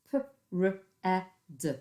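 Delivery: P-R-E-D split into separate sounds; teacher sounds out letters for children; sounding out letters. Shown as a demonstration. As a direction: sound out P-R-E-D one phoneme at a time. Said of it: "I'm going to sound it out".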